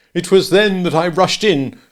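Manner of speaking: loud, mocking himself; sneering at his own outburst; self-scorning. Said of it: "scorning himself".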